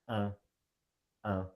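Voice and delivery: "un" with the mouth slightly open, nasal. Shown as a demonstration. Teacher demonstrating nasal "un" to class. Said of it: "your nose should vibrate a bit".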